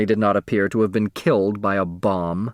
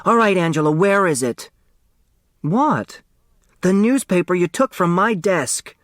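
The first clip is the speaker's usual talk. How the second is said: put-on higher, sharp accusing kid; furious; 9 semitones higher than usual. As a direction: demand it back, angry. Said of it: "a furious turtle".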